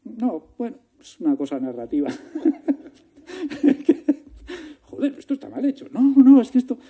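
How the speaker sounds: high, loud nervous laughing excuses; flustered laughing excuse. Retold or imitated. Director laughing off the producer's complaint, high and loud. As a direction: laugh your way through a lame excuse.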